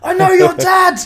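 very high, loud shouted Oi; old Rex yelling at the cheeky juvenile; angered bellow. Retold or imitated. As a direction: bellow like a furious old man. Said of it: "the T-Rex in his angered bellow going".

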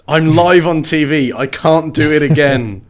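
hammed-up dismay; mock panic. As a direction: sound caught out on air. Panicking astronaut aside.

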